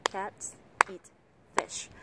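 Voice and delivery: CATS, EAT, FISH on three equal beats; three-beat rhythm. Shown as a demonstration. Teacher timing the sentence to three claps, evenly stressed. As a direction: land each of cats, eat, fish on a clap. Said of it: "khoảng ba nhịp vỗ tay".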